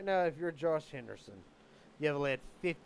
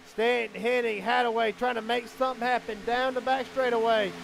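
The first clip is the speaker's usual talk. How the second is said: raised, driving race commentary; live race play-by-play; 6 semitones higher, 7 dB louder than usual. Real, not acted. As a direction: call the laps with building urgency.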